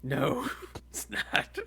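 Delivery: slow whispered giggle; laughing whisper. Retold or imitated. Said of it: "Tries to sell it on the street".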